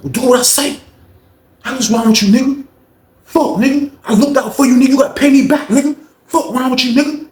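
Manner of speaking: high-pitched heated mock yelling; furious imitation of an attacker; retold angry tirade. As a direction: berate him mid-fight, pitched high. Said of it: "He was like".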